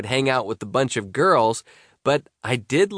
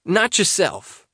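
a hint of a worried buddy; teen boy voice; 8 semitones higher than usual. Tarek voiced by reader.

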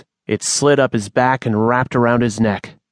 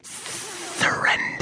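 slow, drawn-out forceful whisper; commanding snake whisper; 9 dB quieter, much slower than usual. Acted. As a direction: order him in a hissing rasp. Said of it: "it commanded".

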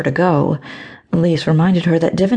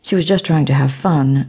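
ordinary delivery, nothing marked

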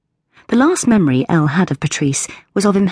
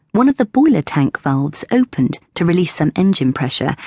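ordinary delivery

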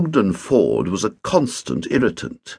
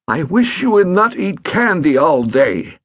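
higher, louder scolding; irritated stepfather; 8 semitones higher, 3 dB louder than usual. A narrator's reading. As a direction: nag him, fed up.

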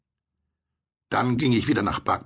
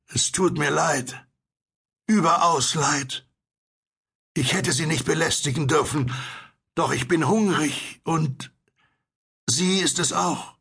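hopeless, pitiful; 9 semitones higher than usual. A narrator's reading. Starving stranger apologises from his distant boat, hopeless and pitiful. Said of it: "sie klang überaus hoffnungslos und erbarmenswürdig".

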